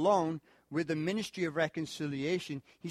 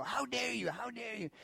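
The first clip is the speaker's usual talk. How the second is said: mock riled-up; 8 semitones higher, 4 dB quieter than usual. Person confronted about a bad relationship, mock indignant. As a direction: imitate someone riled up, voice pitched high. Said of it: "they get all riled up".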